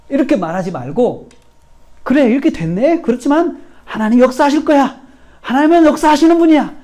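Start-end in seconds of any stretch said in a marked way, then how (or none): 2.0-6.7 high-pitched, emphatic declaration of faith; 확신에 찬 선포. Shown as a demonstration. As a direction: model resolve in a raised, driving voice. 본보기로 보인 믿음의 선포. (높고 힘찬 목소리로 확신 있게)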